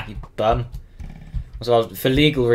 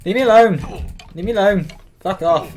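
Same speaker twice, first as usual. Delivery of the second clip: frightened yelling; 8 semitones higher, 5 dB louder than usual. Real, not acted. Fleeing mobs in game.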